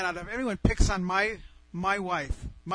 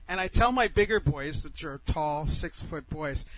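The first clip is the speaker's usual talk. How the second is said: ordinary delivery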